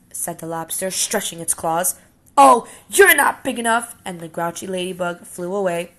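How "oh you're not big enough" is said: loud, high, dismissive sneer; scornful brush-off. Acted. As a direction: wave him off to save face. Grouchy ladybug backs off.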